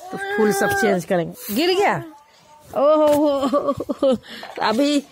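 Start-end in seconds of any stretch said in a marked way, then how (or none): 1.6-5.0 high startled oho with a laugh; surprised oho. Real, not acted. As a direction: react with a laughing oho as the toy drops. Toy falls, mother exclaims. (खिलौना गिरने पर हँसता हुआ ओहो)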